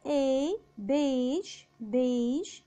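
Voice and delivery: "إي" alone, then "بيج" with that e; "بيج" twice, vowel held; EI as open e. Shown as a demonstration. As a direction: say French "بيج" with long e, zh ending. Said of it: "متبوع بحرف الإي بيتنطق إي".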